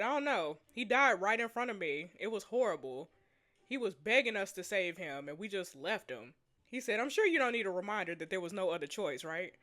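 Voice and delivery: subdued, low dialogue reading; story reading. Acted. Reads sombre story dialogue.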